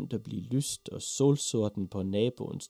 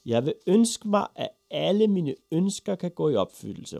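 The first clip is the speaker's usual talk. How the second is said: put-on high, loud boy's proclamation; eager, high-pitched announcement; excited shout; 8 semitones higher, 7 dB louder than usual. A narrator's reading. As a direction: call the wish out at full voice. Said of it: "råbte Ivan Olsen".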